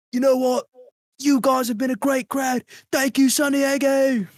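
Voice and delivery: rock star to crowd. Pretend. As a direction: thank the stadium like a rock star.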